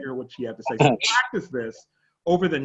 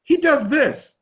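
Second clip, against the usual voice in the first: imitating the wife; 10 semitones higher than usual. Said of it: "His wife said".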